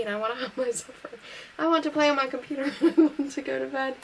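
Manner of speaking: breaking into laughter while reading; laughing. Real, not acted. Narrator laughs through child's wish list.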